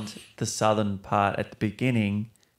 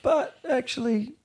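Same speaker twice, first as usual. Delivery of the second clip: sudden high lift on the words, retold; playful jump up in pitch for an inner voice; mock realization. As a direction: pivot up as if it just dawned on you. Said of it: "and then you're like".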